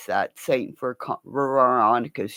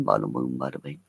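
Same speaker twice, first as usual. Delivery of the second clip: mock New York gangster; 8 dB quieter, much faster than usual. Shown as a demonstration. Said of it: "talk like this".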